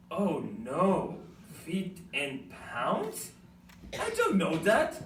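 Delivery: ordinary talk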